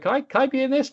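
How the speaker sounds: put-on high, eager kid voice; mock kid asking to join; imitating a kid. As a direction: beg to be included like a fan kid. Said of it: "some kids will send in clips".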